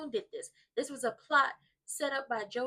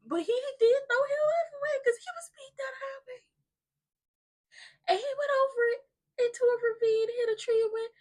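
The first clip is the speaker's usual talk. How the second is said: hammed-up sobbing, high-pitched; fake-wail the tragic news; mock crying; 12 semitones higher, 4 dB louder than usual. Mock mourning over character's crash.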